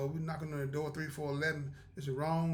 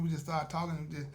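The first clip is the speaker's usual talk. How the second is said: ordinary talk, nothing marked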